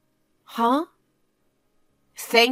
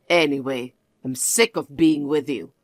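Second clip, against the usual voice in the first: lower, cold lecturing voice; condescending husband; 7 semitones lower than usual. Acted. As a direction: talk down to your wife, low and smug. Husband voice again.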